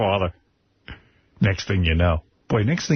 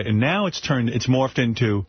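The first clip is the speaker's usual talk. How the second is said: ordinary delivery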